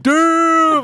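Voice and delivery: high, very loud drawn-out shout; crowd jeer. Retold or imitated. Player imitates the whole lobby reacting to his comeback, long loud jeer.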